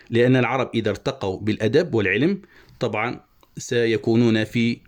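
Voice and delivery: ordinary talk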